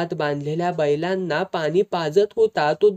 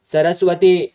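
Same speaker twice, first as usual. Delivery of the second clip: husband roars his wife's name in anger; roaring; 7 dB louder than usual. Acted. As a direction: bark the wife's name, much louder. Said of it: "Damu गरजला".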